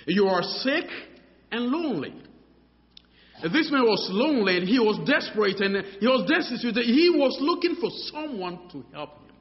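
full-force preaching. Acted. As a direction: preach the man's despair to the crowd.

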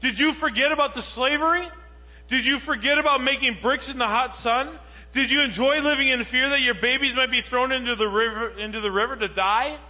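full-force preacher scolding the Israelites; incredulous preaching. Acted. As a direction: hammer each question at the crowd. Preacher confronts listeners incredulously.

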